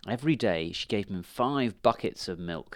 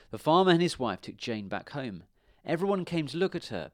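ordinary delivery

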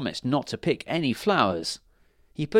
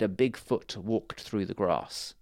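ordinary delivery

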